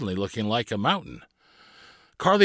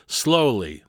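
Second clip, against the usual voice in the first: plain reading voice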